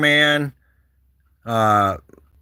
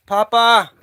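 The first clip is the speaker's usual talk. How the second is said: high called question; high rising call; calling out a name; 8 semitones higher, 5 dB louder than usual. Real, not acted. Calling cat named Papa.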